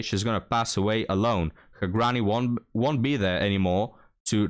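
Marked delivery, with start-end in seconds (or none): none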